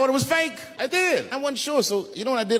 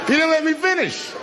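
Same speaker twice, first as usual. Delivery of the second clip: indignant shout; 14 semitones higher than usual. Real, not acted. Comedian to a groaning audience, indignant shout. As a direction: stop the crowd, I'm not done.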